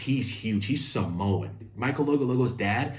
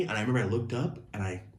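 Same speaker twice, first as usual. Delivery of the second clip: ordinary talk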